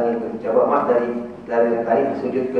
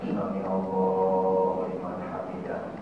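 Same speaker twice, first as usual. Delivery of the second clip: soft slow call; 4 semitones lower, 11 dB quieter, slower than usual. Shown as a demonstration. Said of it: "demonstrating the imam's call".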